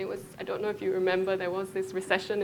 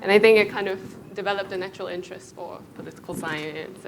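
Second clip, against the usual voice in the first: usual speaking voice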